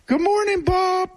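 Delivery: high squawky parrot greeting. Retold or imitated. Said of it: "he'd wake up every morning to".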